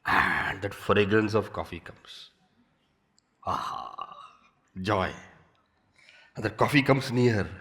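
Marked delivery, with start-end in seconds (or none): 3.4-4.2 soft aha. Retold or imitated. Joy at coffee arriving.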